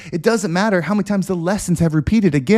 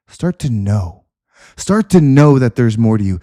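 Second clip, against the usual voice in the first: lower, steady breathing-guide voice; calm meditation lead; guided meditation; 4 semitones lower than usual. Acted. Leads a breathing visualization.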